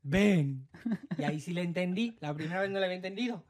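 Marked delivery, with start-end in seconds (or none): 0.0-0.7 stern cop order. Retold or imitated. Cop repeating his order.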